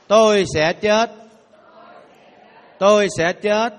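"Tôi sẽ chết" said loudly, slow and firm. Shown as a demonstration. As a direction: proclaim "Tôi sẽ chết" out loud. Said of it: "đọc lớn lên". (đọc lớn lên)

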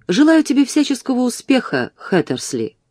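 high, mocking call back. A narrator's reading. Arthur mocking his struggling friend, calling back. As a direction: toss the jeer back across the room, lightly voiced. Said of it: "крикнул в ответ Артур".

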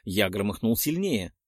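ordinary delivery, nothing marked

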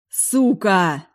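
loud furious curse; furious yell. Acted. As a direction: yell it in rage. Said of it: "орал Джейс".

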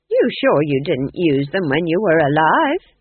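slow, pointed and suspicious; sharp. Acted. Nanny Ogg turning suspicious on the ghost king, sharp and slow. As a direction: narrow it down on him, sharp.

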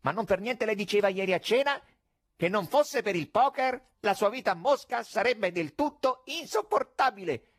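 mocking stage magician; conjurer's call. A narrator's reading. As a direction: toss it out to the stalls.

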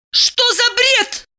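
high-pitched enraged woman; furious cry. Acted. Enraged woman rejects lover's accusation, crying out. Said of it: "закричала она".

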